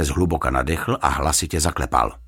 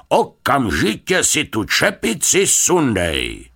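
high, theatrical indignation building to a loud order; imperious scolding; 6 semitones higher, 3 dB louder than usual. A narrator's reading. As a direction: scold him like an outraged stage actor. Pompous actor scolds, orders hat off.

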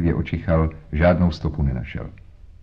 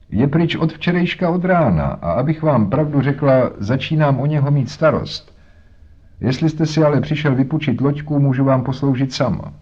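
a hint of a chatty, higher-pitched working woman; lightly voiced boatman's wife; 7 semitones higher than usual. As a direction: talk like a harried mother. Voices Mrs Smith.

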